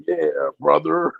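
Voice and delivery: slow, lazy imitation of a deep voice; dopey deep voice. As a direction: drawl it out like a seventies pimp. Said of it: "What are you, a seventies pimp?".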